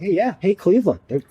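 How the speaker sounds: lifted, high voice quoting a fan; perky imitated enthusiasm. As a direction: pipe up like an upbeat sports host. Voicing upbeat podcast reaction to Browns.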